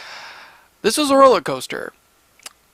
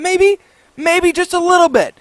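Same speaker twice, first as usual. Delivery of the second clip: high sarcastic maybe; 9 semitones higher than usual. Real, not acted. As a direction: drip sarcasm on it. Sarcastic rhetorical question.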